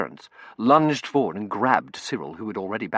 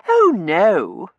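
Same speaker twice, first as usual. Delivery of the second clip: put-on girlish denial; 11 semitones higher, 13 dB louder than usual. A narrator's reading. Girl corrects him breezily.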